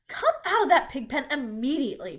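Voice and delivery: high, loud scolding order; cross mother raising her voice; cried out. A narrator's reading. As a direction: order the child out at once. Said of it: "cried Missus Arabelle".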